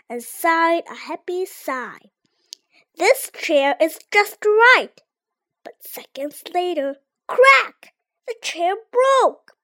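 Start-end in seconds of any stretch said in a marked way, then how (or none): 2.9-5.0 delighted. A narrator's reading. Happy sigh, just right. 7.3-7.9 sharp high crack; loud crack sound. A narrator's reading. Chair breaks.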